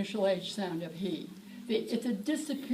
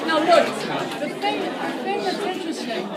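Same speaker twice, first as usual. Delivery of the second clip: calling out; 6 semitones higher, 8 dB louder than usual. Real, not acted. Teacher calling over the noise.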